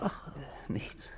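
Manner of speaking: unvoiced, fading whisper; whispered retreat. Husband dropping his question to the inspector, whispered. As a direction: back away from the question under your breath.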